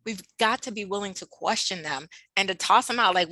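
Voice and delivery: ordinary talk, nothing marked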